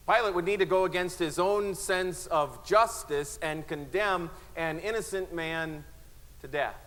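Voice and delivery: preaching climax. Acted. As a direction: press the point home with rising force.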